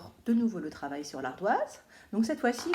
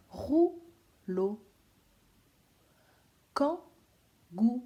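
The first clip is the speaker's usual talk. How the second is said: kangourou drawn out slowly, syllable by syllable; 4 semitones higher, 4 dB louder, much slower than usual. Shown as a demonstration. Teacher to pupils, spelling out syllables slowly. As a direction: stretch each syllable of kangourou. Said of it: "identifier quelle syllabe on entend".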